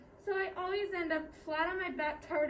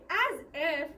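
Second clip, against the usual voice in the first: loud, dismissive brush-off; scornful rebuff; 6 dB louder than usual. Acted. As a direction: shoot the flatterer down.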